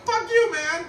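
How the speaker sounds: sudden high-pitched mock yell that drops back down; hammed-up jealous rant at the baby; comic high yelp of outrage, then a muttered grumble; mock angry outburst. Resentful father yelling at baby.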